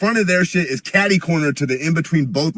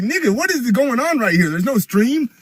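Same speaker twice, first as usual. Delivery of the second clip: baffled inner voice; 12 semitones higher than usual. Retold or imitated. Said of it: "I'm standing here like".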